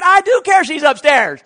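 high, loud indignant comeback; shouted retort. Retold or imitated. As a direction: yell back, refusing to give in. Acts out his retort.

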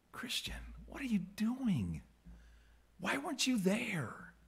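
hushed, slow, pointed whisper; harsh questioning. Acted. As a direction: press the doubter in a whisper. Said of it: "harsh with you".

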